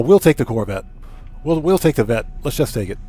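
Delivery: ordinary talk, nothing marked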